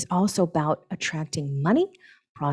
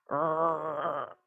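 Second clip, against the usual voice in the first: soft mock growl through the teeth; angry growl; 6 dB quieter than usual. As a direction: growl quietly like grinding your teeth. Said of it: "When we go".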